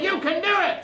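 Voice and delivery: pitched high, sung out for the crowd; rally them with a cheer; encouraging cheer prompt. Shown as a demonstration. Performer cueing the audience to cheer, encouraging. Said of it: "Everybody say".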